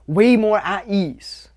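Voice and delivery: drawn out, slow and easy; slow relaxed emphasis. Shown as a demonstration. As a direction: stretch it out, calm and easy.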